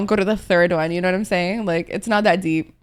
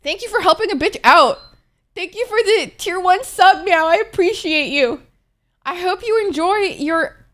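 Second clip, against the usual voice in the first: higher, sing-song grateful voice; cutesy thanks; 9 semitones higher, 3 dB louder than usual. Acted. Streamer thanking a tier one subscriber, cutesy high voice. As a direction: thank them like it's adorable.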